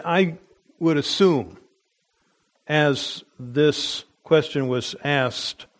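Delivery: normal talking voice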